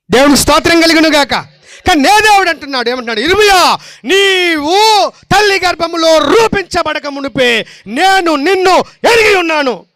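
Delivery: high, loud, ringing declaration of truth; fervent preaching. Acted. Preacher to the congregation, fervent loud preaching. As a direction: proclaim it to the whole church.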